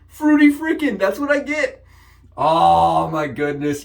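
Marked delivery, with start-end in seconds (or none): 0.1-1.8 gleeful squeal. Real, not acted. Gleeful repeat about cereal treat.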